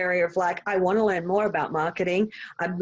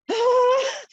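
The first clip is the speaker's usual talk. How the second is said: high laugh; 4 dB louder than usual. Real, not acted. Laughing at herself.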